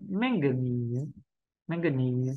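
"manganese" in three syllables, no vowel after the last consonant; final schwa dropped. Shown as a demonstration. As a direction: say "manganese" low, ending without an added schwa. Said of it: "hãy bỏ đi âm ờ".

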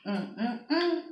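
humming while chewing; savoring food mid-bite; mouth full, chewing. Real, not acted. Seen 1: holds lobster, bites at 2.9, chews through the hums.

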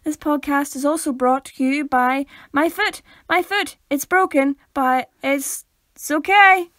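loud, high, playful announcing; mock sponsor read. Co-host crediting a broken foot, loud mock ad read. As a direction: proclaim the daft sponsor, building to the end.

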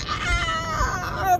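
long, very high-pitched newborn wail; imitated baby's scream. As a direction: screech like a crying baby. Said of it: "just crying and screaming".

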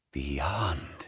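breathy whispered echo of the word. Acted. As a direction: whisper it to yourself, lost in thought. Gabriel repeats the word, whispering.

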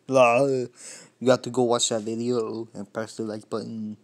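loud, put-on Austrian accent; Arnold Terminator. Shown as a demonstration. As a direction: plug the video in a hammy Austrian accent. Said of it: "the Terminator. My best one".

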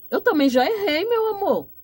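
a high, earnest plea of regret; heartfelt admission. Retold or imitated. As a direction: pitch it up high, pleading sincerely. Voicing a humble confession.

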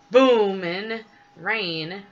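loud, drawn-out grumble; storybook workman's moan; grumbling complaint. A narrator's reading. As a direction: grumble it slowly, leaning hard on the first word. Ted complains about rain in story.

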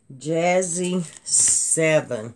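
slow repeat. Shown as a demonstration.

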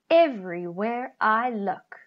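usual speaking voice

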